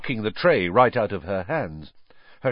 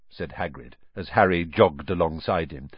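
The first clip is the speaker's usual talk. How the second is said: ordinary delivery, nothing marked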